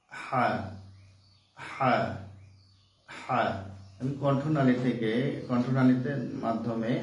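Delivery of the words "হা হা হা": হা breathed from the throat, not Bengali হা; Arabic throat ha. Shown as a demonstration. Teacher showing the Arabic ha, not Bengali. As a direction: press lightly in the throat for হা. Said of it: "তো এটাকে আমরা বলি হা".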